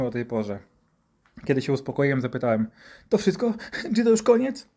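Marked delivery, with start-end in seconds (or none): 3.1-4.7 put-on high, plaintive boy's voice; acted high-pitched anxious questioning; timid child. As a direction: ask hopefully, like a frightened kid. Hurt child asks if it's over.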